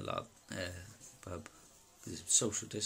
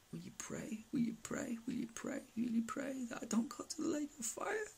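much higher whispered whimpering plea; almost crying. Retold or imitated. The demon begging the teller to pray, imitated tearful whisper. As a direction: beg him to pray, near tears, in a thin whisper. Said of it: "Almost like he's crying".

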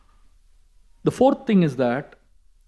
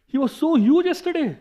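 high-pitched amazed question; imitating a baffled companion; acted astonishment; 9 semitones higher, faster than usual. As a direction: raise the pitch in disbelief, quickening. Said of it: "Balaram said".

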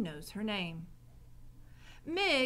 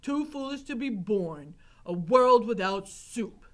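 put-on emphatic old-man complaint, louder on the end; grumbling Gregory; 9 dB louder than usual. A narrator's reading. Gregory lamenting soup over his plate, emphatic grumble. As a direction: grumble it in a heavy character voice. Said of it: "muttered Gregory as he lifted the cover".

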